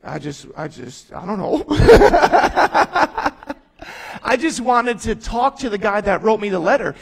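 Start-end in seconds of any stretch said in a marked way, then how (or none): 1.7-3.5 big burst of real high laughter; loud, high, disbelieving laugh. Real, not acted. Laughing at the unlikely letter.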